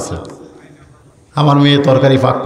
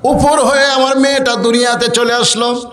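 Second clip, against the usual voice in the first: melodic waz preaching; 7 semitones higher than usual. A narrator's reading. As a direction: chant it like a preacher, holding the high note. Waz preacher chants the narration.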